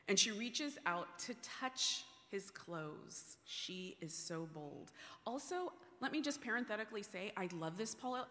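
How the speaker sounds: ordinary talk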